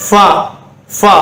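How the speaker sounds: ஃபா as a short 'fa' with air; ஃபா with a breath on the f; airy f. Shown as a demonstration. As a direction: blow air through the f of ஃபா. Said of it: "காத்து".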